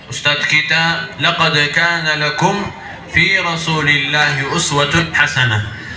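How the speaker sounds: usual speaking voice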